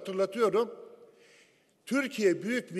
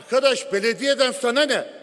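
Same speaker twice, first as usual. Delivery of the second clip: ordinary talk, nothing marked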